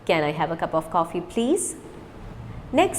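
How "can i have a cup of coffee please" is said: quick, words run together; fast. Shown as a demonstration. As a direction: rattle it off fast. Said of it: "Now repeat with me fast".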